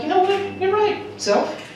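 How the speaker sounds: answering self. Retold or imitated. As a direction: give in to your own scolding, small and high. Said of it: "And so I was like".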